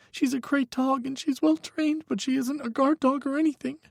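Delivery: put-on high, tense young voice; a frightened young man pleading on the phone; young man, scared. A narrator's reading. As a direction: Micah telling Hawk his ex is dangerous, high and scared. Micah's voice.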